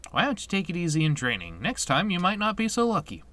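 quick, lifted scolding advice; advising game doctor. Acted. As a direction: chide a reckless patient briskly. Voices doctor advising Squall.

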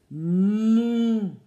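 the cow scaring the farmer; loud moo. A narrator's reading. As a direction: bellow like a cow. Cow mooing.

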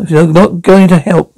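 usual speaking voice

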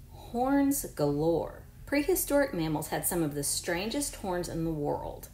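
clear, even read-aloud voice for a class; reading to children. Acted. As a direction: read the book to your pupils. Reads book aloud to class.

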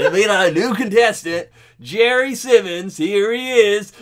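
high, bright host announcement; game show host. Pretend.

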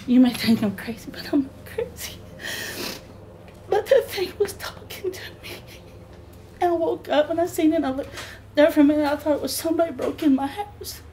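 high, tearful, breaking voice; real crying. Real, not acted. Crying while describing the apparition.

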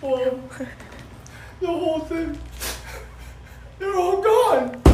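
mock despair. Teen lamenting to her mother that they're all gone, mock despair. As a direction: ham up the tragedy. Mourning a loss dramatically.

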